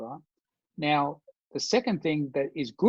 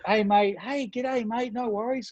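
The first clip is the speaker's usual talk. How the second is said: high, sing-out Aussie matey hello; friendly mock greeting; 7 semitones higher than usual. Defusing trouble as self-defense, hammed-up friendly greeting. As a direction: play harmless and chummy, pitched high.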